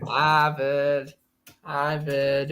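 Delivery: "Harvard" as hah-vid with no r; Boston accent. Shown as a demonstration. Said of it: "It's Ryan Donato from Harvard".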